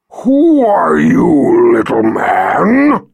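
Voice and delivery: slow, loud menacing dragon voice; growling dragon guarding treasure. Acted. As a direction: loom over him, rumbling and slow. Dragon confronts Richard.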